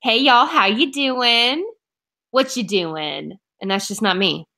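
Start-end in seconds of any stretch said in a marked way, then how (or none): 0.0-3.4 slow, drawn-out Oklahoma drawl imitating mom; imitating mom's Oklahoma accent. Imitating her Oklahoma mom's greeting, slow Oklahoma drawl. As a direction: lightly mimic a mom's Oklahoma twang, slow. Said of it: "you talk to my mom and it's".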